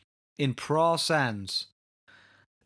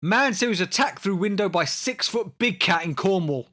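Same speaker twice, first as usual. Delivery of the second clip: higher, punched-up headline delivery; dramatic headline read; 5 semitones higher, 4 dB louder than usual. Acted. Host reading big cat story to co-host, dramatic headline. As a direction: announce it like breaking tabloid news.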